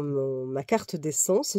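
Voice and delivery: usual speaking voice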